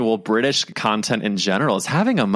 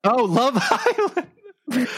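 laughing. Real, not acted. Laughing at the accent.

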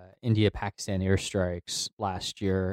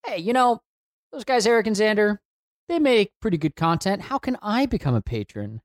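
much higher, keen fan voice; eager listener voice; 11 semitones higher than usual. Retold or imitated. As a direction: play an excited fan asking. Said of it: "someone listening ... thought to themselves".

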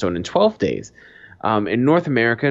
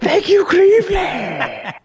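high, slow stage shout with a laugh; rock star shout; 5 dB louder, slower than usual. Pretend. Mock rock star thanking the crowd, high laughing shout. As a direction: yell it like a rocker leaving the stage.

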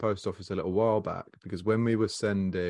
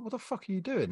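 high-pitched, softened disbelief; retold baffled complaint, pitched up; incredulous protest; 10 semitones higher, 4 dB quieter than usual. Customer retelling a row with the post office, incredulous.

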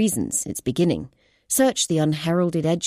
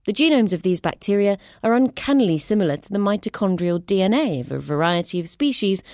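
usual speaking voice

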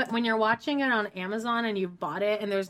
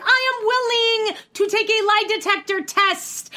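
high indignant Amber; 9 semitones higher, 4 dB louder than usual. Retold or imitated. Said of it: "she's like".

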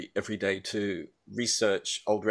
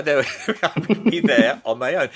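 laughing aside; 3 semitones higher, 7 dB louder than usual. Real, not acted.

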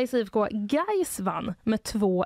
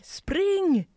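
higher, drawn-out mock yell; retold police shout; 10 semitones higher, 3 dB louder than usual. Radio host retelling police running up to bystanders, imitated shout. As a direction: call out an imitated police order. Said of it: "som skrek".

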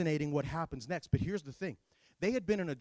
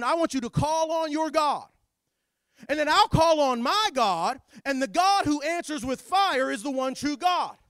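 louder, higher street-style prophet throwing down the dare; brash showdown challenge to a crowd; 6 semitones higher, 9 dB louder than usual. Acted. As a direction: call out the rival prophets with swagger. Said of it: "This is how Elijah talks in my world".

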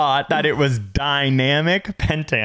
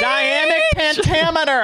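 much higher, grand announcer voice; mock dramatic. Shown as a demonstration. Teasing his cohost, grand high hammed-up announcing. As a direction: boom it out like a showman. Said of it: "make your voice go up and down".

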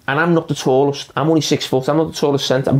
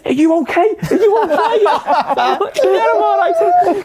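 much higher, giggly impression of a concerned teammate; laughing mock Owen. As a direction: squeak it out through laughter. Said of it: "He went".

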